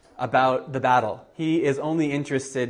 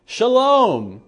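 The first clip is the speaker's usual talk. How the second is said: much higher, louder joyful call; excited shout; 10 semitones higher, 7 dB louder than usual. Retold or imitated. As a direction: shout the good news while running. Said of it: "as he's running, he just says".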